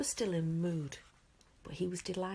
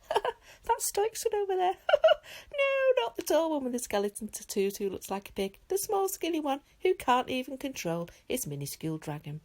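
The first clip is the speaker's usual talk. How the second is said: mocking gossip; 9 semitones higher, 10 dB louder than usual. A narrator's reading. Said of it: "the crowd whispering to each other".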